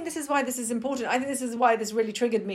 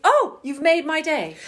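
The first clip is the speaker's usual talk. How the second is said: delighted thanks; 7 semitones higher, 6 dB louder, slower than usual. Retold or imitated. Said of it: "Or you say".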